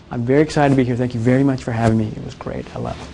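hushed personal note, fading very low; handwriting voice. Shown as a demonstration. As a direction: quietly confide your gratitude. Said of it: "That's handwriting voice".

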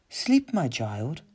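drawn-out, ghostly motherly croon; mother's voice. A narrator's reading. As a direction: lull the child to sleep. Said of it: "said his mother's voice".

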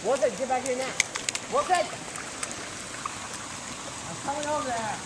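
high distant call after the dog; calling from far away. Real, not acted. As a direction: yell from across the shore.

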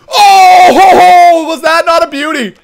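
high, loud "oh-ho-ho" celebration; jubilant shout. Real, not acted. Gamer celebrating a beauty of a goal, jubilant. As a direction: crow loudly over the goal.

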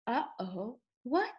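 ordinary delivery, nothing marked

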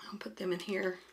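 breathy unvoiced whisper. Real, not acted. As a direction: whisper it to yourself. Quiet aside while placing pieces.

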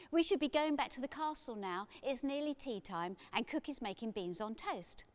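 put-on a sensible child calling them home; P J, sensible girl. A narrator's reading.

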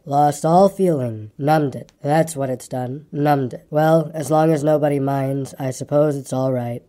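slow, low gloomy donkey voice; low, slow martyred resignation; melancholy grumbler. Acted. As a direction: drag it out low, feeling sorry for yourself.

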